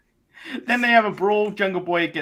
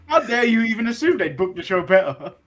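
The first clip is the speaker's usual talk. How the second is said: mock outrage; 7 semitones higher, 5 dB louder than usual.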